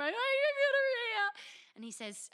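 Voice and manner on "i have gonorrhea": high, loud wailing sob; loud, high crying confession; mock sobbing. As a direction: wail it out in fake hysterics. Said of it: "I'm in hysterical tears".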